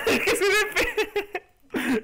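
slow, high, through laughter; laughing. Real, not acted. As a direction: laugh at your own blunder. Laughing at own mistake.

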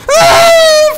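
extremely high, loud shriek; piercing panic scream; terrified scream. Real, not acted. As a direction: scream in sheer fright. Monster attacks.

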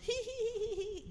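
very high, thin "hee hee hee"; squeaky mock titter; imitated giggling. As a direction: mimic students' little stifled giggles.

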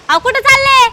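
loud, high-pitched call after someone. Acted. Wife calling after her departing husband, shouted. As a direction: holler after him as he leaves.

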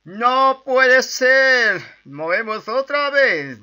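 loud, high, drawn-out mock wail; hammed-up despair. Gamer dying in the match, hammed-up despairing shout. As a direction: cry out melodramatically, stretching every word.

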